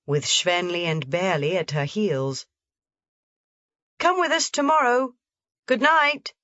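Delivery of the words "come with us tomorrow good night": put-on higher, louder boy calling after her. A narrator's reading. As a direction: call goodbye across the path. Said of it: "Peter called out".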